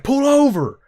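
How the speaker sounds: mock shout at the minivan driver; shouted order. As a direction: yell it across lanes.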